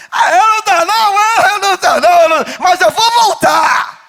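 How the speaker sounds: high, loud, cheeky wail; the grinning man fobbing off the pastor; mock whiny excuse. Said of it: "Tu fala comigo rindo".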